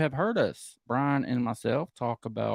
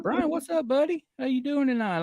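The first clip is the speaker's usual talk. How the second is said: much higher, louder show-host welcome; hyped greeting; 5 dB louder than usual. Acted. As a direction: greet him like an over-excited talk-show host. Host greets guest as Jeremy character.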